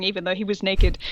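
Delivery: normal talking voice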